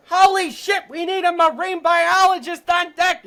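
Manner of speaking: loud, high Boston fisherman's shout; excited Boston yell across a boat deck; thick Boston accent. Retold or imitated. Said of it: "with this thick Boston accent".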